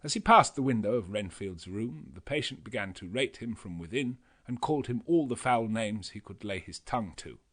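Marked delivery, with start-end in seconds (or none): none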